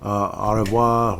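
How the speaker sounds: a slow, drawn-out French au revoir; hammed-up French goodbye; mock French. Mock French farewell to Rudy.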